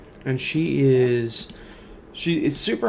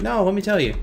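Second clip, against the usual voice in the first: a hint of a higher, excited kid; eager little girl; 6 semitones higher than usual. Little girl eager to tell mom her news, lightly voiced kid. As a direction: blurt it out like a kid with a secret.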